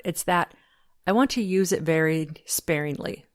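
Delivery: normal talking voice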